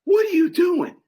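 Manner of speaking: high exasperated demand; angry homeowner at the window; indignant. Retold or imitated. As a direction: confront the stranger. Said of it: "And I said".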